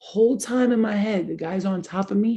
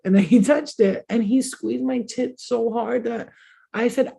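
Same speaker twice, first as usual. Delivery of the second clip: ordinary talk, nothing marked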